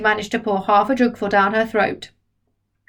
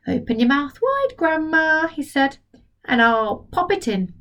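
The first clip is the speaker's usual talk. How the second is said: put-on higher, coaxing boy voice; 5 semitones higher than usual. George coaxes Grandma to take the spoon, higher voice. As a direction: coax her gently.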